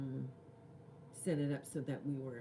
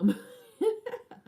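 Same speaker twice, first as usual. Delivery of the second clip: high loud burst of laughter; 11 dB louder than usual. Real, not acted. Admitting she makes people cry, a real laugh.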